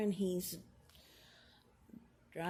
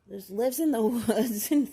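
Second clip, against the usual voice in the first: amused, louder, laughing through the words; 9 dB louder than usual. Real, not acted. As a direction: let a laugh burst into the phrase. Amused at her own description.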